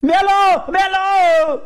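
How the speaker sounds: put-on screaming boy. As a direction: scream in agony. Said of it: "ओरडायला लागलं".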